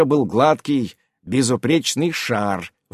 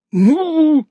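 creature prod; 7 dB louder than usual. A narrator's reading. Baby creature prods.

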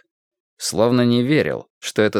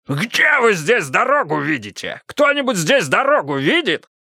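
high furious yelling; 9 semitones higher than usual. Acted. Karpov keeps shrieking.

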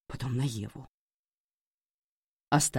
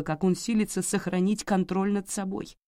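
usual speaking voice

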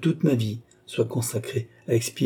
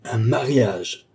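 slow, drawn-out disbelief; scornful, lingering echo; proud indignation; 3 semitones higher, much slower than usual. A narrator's reading. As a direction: stretch the word out with disdain. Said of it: "s'exaltant de plus en plus".

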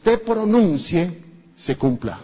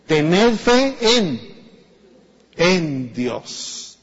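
slow solemn quote; much slower than usual. Retold or imitated. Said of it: "¿qué le dice el Señor a Pedro?".

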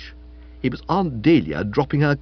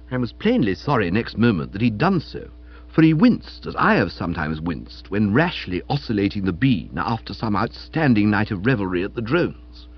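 usual speaking voice

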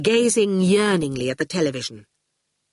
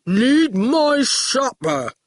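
slow, higher, mournful oaf voice; sad goon; 7 semitones higher, 3 dB louder, much slower than usual. A narrator's reading. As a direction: whine slowly like a hungry oaf. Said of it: "the goon said sadly".

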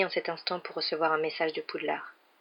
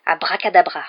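a fierce, louder incantation; 8 dB louder than usual. A narrator's reading. Harry scaring Dudley with a fake spell, fierce. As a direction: growl the spell to scare him. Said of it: "d'une voix féroce".

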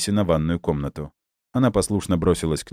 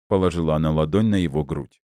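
usual speaking voice

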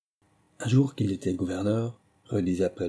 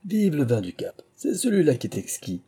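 higher, delighted toast; exclaiming with delight; 7 semitones higher than usual. A narrator's reading. Belle-Rose toasting Cape wine at dessert, delighted exclamation. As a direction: cry out your love of the wine. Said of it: "s'écriait-il".

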